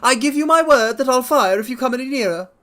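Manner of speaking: very high, loud threatening squeak; frightened fat patient warning intruders, very high; reedy, quivering voice. Acted. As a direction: threaten from the dark in a high panicked voice. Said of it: "a reedy, quivering voice".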